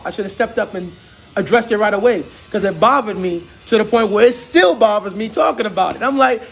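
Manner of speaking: usual speaking voice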